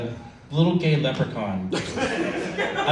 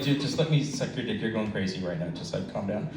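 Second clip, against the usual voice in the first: soothing; 8 semitones lower, 8 dB quieter than usual. Retold or imitated. Soothing panicking boyfriend.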